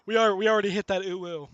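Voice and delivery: ordinary talk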